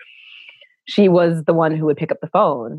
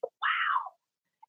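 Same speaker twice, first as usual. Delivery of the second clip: awed whisper; 13 dB quieter than usual. Retold or imitated. Awed at the vanished show.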